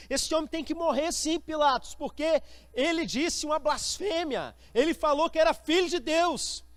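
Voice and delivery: high, insistent accusing outcry; acted accusers. Accusers demanding a death from Pilate, high and heated. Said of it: "as pessoas que estão acusando viram e falam assim".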